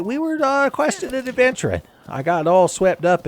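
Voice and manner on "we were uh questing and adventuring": excited. Real, not acted. Sudden high excited remembering.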